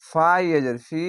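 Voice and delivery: ordinary delivery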